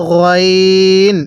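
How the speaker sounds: গইন with a throaty gh and long held ee; slow, drawn-out model গইন; demonstrated letter name. Shown as a demonstration. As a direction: stretch গইন as "gho-eeen", level. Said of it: "এরকমভাবে শিখব".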